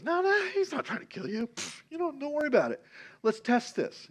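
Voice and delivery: high-pitched, light brush-off of a worry; dismissive reassurance. Retold or imitated. Jonathan telling David his dad isn't out to kill him, dismissive.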